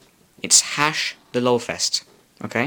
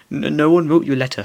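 higher, louder, panicked stammer; squealing; 5 semitones higher, 4 dB louder than usual. Acted. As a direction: squeal it out in a scared rush. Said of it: "squealed Vernon".

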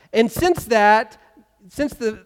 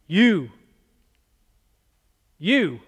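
accusing you. Retold or imitated. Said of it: "Don't you love it when somebody says that?".

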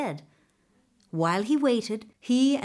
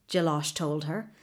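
ordinary delivery

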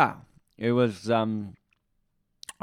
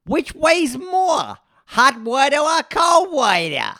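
high, drawn-out yell; hammed-up shout; 11 dB louder, slower than usual. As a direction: shout it slowly and very high, full force. Opens with a performed shouted question.

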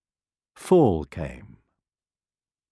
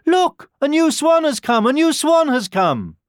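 put-on high, loud child's cry; children on the shore spotting the new swan, shouting excitedly; 7 dB louder than usual. A narrator's reading. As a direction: call out like thrilled kids. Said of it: "a group of children cried out".

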